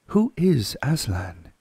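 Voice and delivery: put-on curious girl asking slowly; Susan asking. Susan asking Mr Beaver, wondering girl voice.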